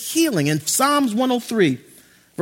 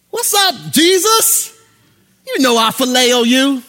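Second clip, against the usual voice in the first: much higher, loud breezy greeting; casual Peter; 4 semitones higher, 6 dB louder, slower than usual. Acted. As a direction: toss it off like a buddy. Peter greets Jesus casually again.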